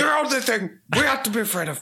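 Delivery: high, fast mock Bernie voice; Bernie Sanders impression. Co-host riffing on the JFK quote, fast and high as Bernie. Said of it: "you mean like Bernie Sanders".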